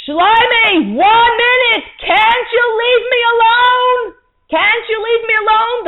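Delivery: screaming high and loud, stretching each word; a frazzled mother shrieking at her child. Acted. Mother screaming at her pestering son, shrieking. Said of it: "she said in a loud voice".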